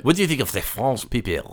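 put-on French accent; mock French question. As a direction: ask it as a hammed-up Frenchman. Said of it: "best French voice on for this".

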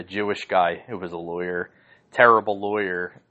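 usual speaking voice

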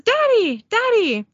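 lightly voiced child running to her dad; little girl. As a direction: call for daddy like an excited kid. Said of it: "a little girl runs out ... She says".

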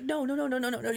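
high, repeated frustrated protest; mock upset. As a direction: act out a teacher losing patience. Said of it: "he's getting, like, upset".

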